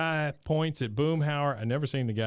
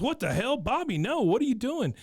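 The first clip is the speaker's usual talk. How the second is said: alarmed high yell; 11 semitones higher than usual. Retold or imitated. Hank alarmed yelling.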